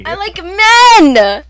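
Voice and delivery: loud, slow, high declaration; mock proclamation. Streamer loudly joking to her chat. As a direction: announce it to the whole room.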